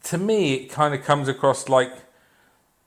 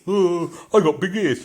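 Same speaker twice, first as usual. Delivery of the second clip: higher-pitched mocking royal caricature; Prince Charles; 6 semitones higher than usual. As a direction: lampoon him in a put-on voice. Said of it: "Apart from Prince Charles".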